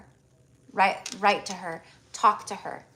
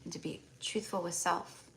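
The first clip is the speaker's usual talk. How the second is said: channelled healing blessing; 2 semitones lower, 9 dB quieter than usual. Acted. Healer clearing a client's energy, soft meditative blessing. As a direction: murmur the invocations gently, breathing out between them.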